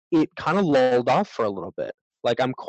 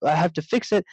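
higher-pitched, mock-panicked self-talk; imitating a rising panic; retold panic; 5 semitones higher than usual. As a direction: replay a moment of fretting, pitch up. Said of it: "I started to panic".

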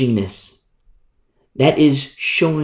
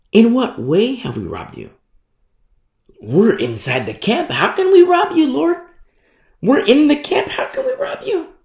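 much higher, disbelieving whine; high incredulous protest; 11 semitones higher than usual. Retold or imitated. The people protesting to the Lord, high whine. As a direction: squeak it out in disbelief.

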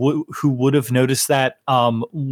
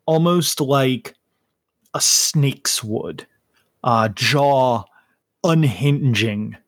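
slow game master narration; 3 semitones lower, slower than usual. Acted. GM describes creature transforming.

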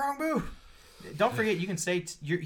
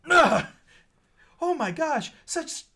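much higher, outraged prissy voice; shocked commander; 5 dB louder, slower than usual. Acted. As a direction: clutch your pearls as the scandalised commander. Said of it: "And he's like".

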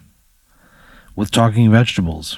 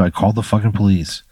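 ordinary talk

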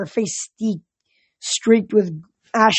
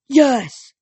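loud, higher cheer; emphatic raised cry of agreement; eager shout; 6 semitones higher, 6 dB louder than usual. A narrator's reading. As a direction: answer like a fired-up crowd. Crowd of monsters answers eagerly.

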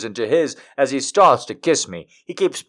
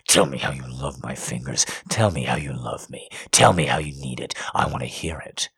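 low, soft, whispering commands; low whispered demand; 11 semitones lower, 7 dB quieter than usual. Acted. Lover demands she tells him, low whisper. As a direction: murmur the orders close.

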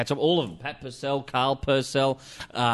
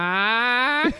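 high, drawn-out crow caw; long, high squawking crow voice; crow impression; 9 semitones higher, 3 dB louder than usual. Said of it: "It's just my Crow impression".

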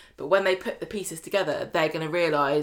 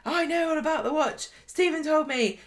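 high-pitched hammed-up outrage; a lightly acted furious confrontation; mock indignant Lou; 9 semitones higher than usual. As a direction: play the storming-in friend, pitched high. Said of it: "Lou storms over going".

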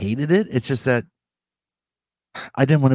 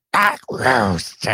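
cartoon villain. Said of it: "You sound like a cartoon villain".

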